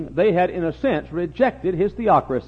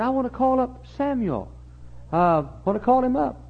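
high, soft put-on voice; high disguised voice of a king; 8 semitones higher, 5 dB quieter than usual. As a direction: lift the pitch to hide who you are. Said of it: "he disguised his voice".

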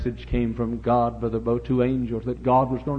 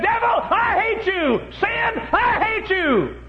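high, drawn-out hollering at the devil; imitated screaming; 3 dB louder, slower than usual. Son preaching against sin, imitated screaming holler. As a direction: mimic a preacher screaming, slowly stretching each cry. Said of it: "he just screamed and hollered".

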